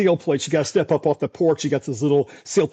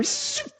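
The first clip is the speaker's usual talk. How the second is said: hail zip sound; 10 dB quieter than usual. Retold or imitated. Imitates hail zipping past.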